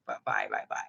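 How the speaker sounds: lightly voiced flustered man rushing off; mock low mumbled bye-bye; hurried goodbye. Man hurriedly leaving for temple.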